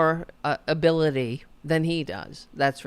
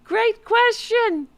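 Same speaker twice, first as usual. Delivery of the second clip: much higher, slow, put-on mocking voice; mocking high parody; 4 dB louder, slower than usual. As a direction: parody his smug praise, slow and high. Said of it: "repeated mockingly".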